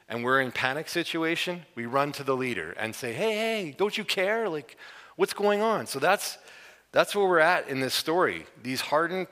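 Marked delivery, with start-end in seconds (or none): 3.1-5.9 high panicked "hey, hey, don't you care"; panicked plea. Retold or imitated. Disciples panicking to their sleeping rabbi, high and frantic. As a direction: plead like a scared fisherman.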